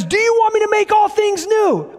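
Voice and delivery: an acted-out God asking; God's voice. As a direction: voice God offering to make all new. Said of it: "God comes down and says".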